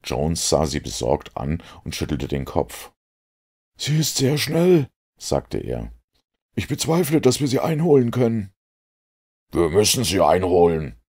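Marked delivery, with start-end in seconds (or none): 3.8-8.5 much higher, worried inspector; Jones's voice. A narrator's reading. Jones doubts they can catch the boat, much higher. 9.5-11.0 louder, clenched determined call; through gritted teeth. A narrator's reading. Holmes insists to the crew, through gritted teeth. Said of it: "rief Holmes zwischen den Zähnen hindurch".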